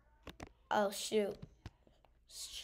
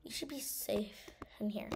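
hushed whisper while hiding; 6 dB quieter than usual. Real, not acted. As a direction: whisper so the monster won't hear.

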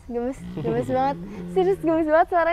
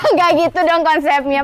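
raised voice, objecting to a pal; loud protest; 7 dB louder than usual. Real, not acted. Host objecting to co-host's silly joke, loud protest.

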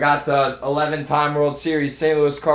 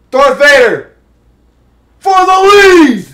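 hype call; 12 semitones higher, 12 dB louder than usual. Real, not acted. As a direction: announce the go-ahead homer. Home run call.

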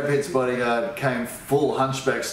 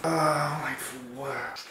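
slow, softer, higher-pitched groan of dismay; dismayed realisation; 4 semitones higher, 5 dB quieter, slower than usual. Acted. Uncle Barry looking at his watch, soft drawn-out dismay. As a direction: drag it out softly, pitched up.

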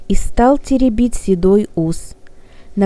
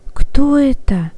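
usual speaking voice

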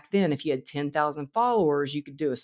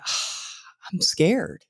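sheepish scared; 3 semitones higher, much slower than usual. Retold or imitated. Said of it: "I said".

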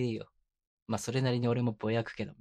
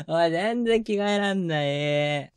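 slow, louder, laughing complaint; exasperated laugh; 6 semitones higher, 6 dB louder, much slower than usual. Acted. Boyfriend failing to dress his playful partner, laughing exasperation. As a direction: drag it out, amused and fed up.

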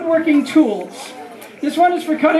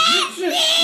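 much higher shouted zzz; hyping kids to buzz louder. Shown as a demonstration. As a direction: yell the saw sound to win the contest. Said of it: "really loud 'cause it's gonna be a contest".

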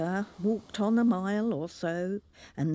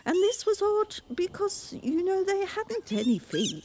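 much higher, wondering; amazed narration; 14 semitones higher than usual. A narrator's reading. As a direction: marvel at the oddity. Narrator marvels at oddity.

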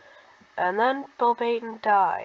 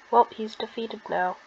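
plain reading voice

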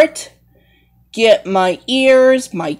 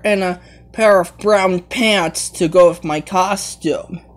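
ordinary talk, nothing marked

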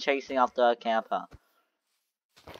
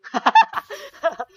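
high burst of laughter; 13 semitones higher, 4 dB louder than usual. Real, not acted. Big laugh at friend.

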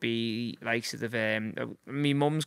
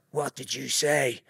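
menacing stranger; slower than usual. Retold or imitated. Scary man answers back.

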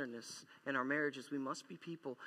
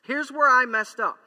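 loud, higher confession, said out to everyone; mock confession; 8 semitones higher, 15 dB louder than usual. Preacher voicing a spouse owning up, loud and high. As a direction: proclaim the wrong openly. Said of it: "We say".

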